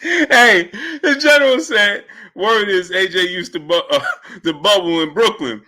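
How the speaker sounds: cracking up while reading; laughing. Real, not acted. Host reading a viewer's joke message, laughing.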